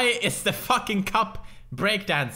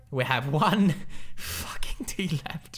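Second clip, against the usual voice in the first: tense whisper; 8 semitones lower, 5 dB quieter than usual. Real, not acted. Gamer down to the last tea, tense whisper. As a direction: hush it as if not to jinx it.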